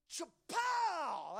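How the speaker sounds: shouted high "Chapow" like a blow landing; chapow sound effect. Shown as a demonstration. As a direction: bang it out like a whip crack. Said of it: "Chapow's the word".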